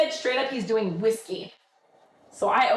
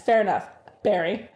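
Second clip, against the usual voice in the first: ordinary talk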